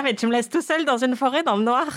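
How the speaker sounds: high panicked protest; freaking out, left alone in the dark; hysterical panic. Pretend. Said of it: "je serais hystérique". (panique hystérique)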